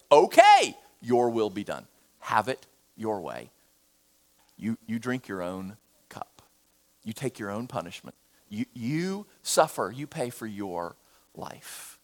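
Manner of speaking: a grave, soft verdict from God, sinking low; God's judgment. Acted. God letting the rebel go, low and soft. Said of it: "God will say".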